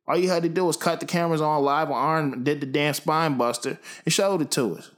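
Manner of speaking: worked up, pitch climbing steadily; indignant rant. Real, not acted. Mock rant demanding the spinebuster.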